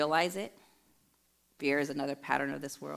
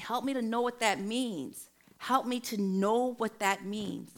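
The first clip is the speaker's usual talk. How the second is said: retold earnest plea; 6 semitones higher than usual. Woman soaking in scripture asks God for help, earnest pleading. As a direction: re-enact begging for understanding, stretching the repeat.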